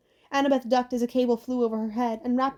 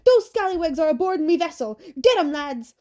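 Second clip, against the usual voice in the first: a loud, higher, gruff-styled pirate bellow; yelling pirate captain; 5 semitones higher, 6 dB louder than usual. A narrator's reading. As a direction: roar it at your crew like an angry pirate captain. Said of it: "Blackbeard yelled".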